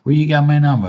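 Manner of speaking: suspicious surprise. Retold or imitated. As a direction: sound caught off guard. Imitated guy's suspicious reply.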